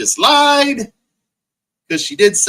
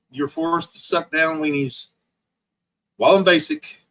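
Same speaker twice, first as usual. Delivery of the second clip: ordinary delivery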